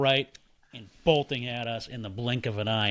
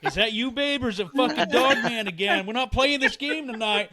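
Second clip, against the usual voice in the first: mock wife voice; 10 semitones higher than usual. Wife voiced suspecting dog man.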